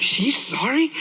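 high rising disbelief; incredulous. Retold or imitated. Husband hearing his wife apologize, incredulous.

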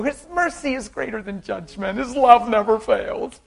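high, loud preaching cadence; preacher declaring God's love to her; preaching at full force. Acted. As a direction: proclaim it over her, fervently. Preaching to the woman.

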